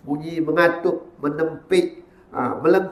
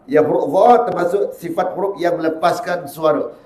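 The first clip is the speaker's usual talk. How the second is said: ordinary talk, nothing marked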